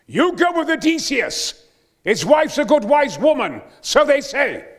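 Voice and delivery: high, loud and slow, ringing out; full-voiced Greek herald; booming declamation. Shown as a demonstration. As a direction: boom the line like a herald to the rafters. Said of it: "this is exactly how I said it".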